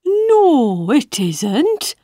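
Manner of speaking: high, fussy caterpillar voice; a prim little bug answering back. Acted.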